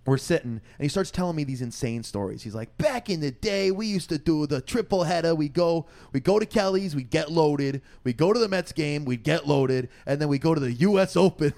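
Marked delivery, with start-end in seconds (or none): 2.8-11.5 higher-pitched imitation of an old New Yorker reminiscing; punchy, put-on New York accent. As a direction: spin a tall tale as an old New York storyteller. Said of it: "old New Yorker".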